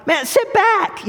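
high, drawn-out mock exasperation; exasperated retold order. Driver to younger brother leaning forward, exasperated.

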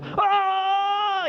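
high, loud cry of pain; patient crying out as the bone is reset. Retold or imitated. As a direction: yelp like the bone just snapped. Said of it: "And I go".

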